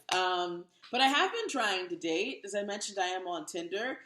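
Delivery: normal talking voice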